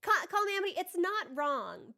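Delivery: normal talking voice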